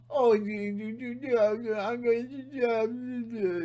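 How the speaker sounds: high, mumbled mock yokel yammer; mock hillbilly babble. Mocking hillbillies' talk for his viewers, high mock yammer. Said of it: "when you talk like that".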